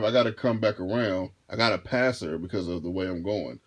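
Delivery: ordinary talk, nothing marked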